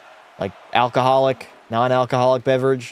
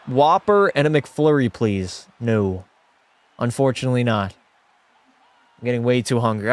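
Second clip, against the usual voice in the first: ordinary delivery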